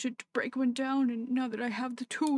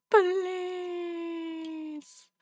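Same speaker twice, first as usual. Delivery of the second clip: higher-pitched, long-stretched begging; drawn-out pleading; 4 semitones higher, much slower than usual. Pretend.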